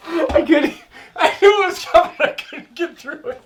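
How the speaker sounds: high and loud, cracking up; laughing. Real, not acted. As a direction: blurt it while laughing. Cracking up after punchline.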